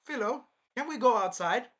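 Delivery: high, loud sing-out to a pet; coaxing Naofumi. Acted. Naofumi calling Philo to go outside, high and coaxing. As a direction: call out high, rising into a question. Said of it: "he would be like".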